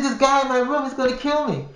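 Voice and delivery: high, urgent retold plea; frantic. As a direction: tell the guard breathlessly it's life or death. Frantic report to security guard.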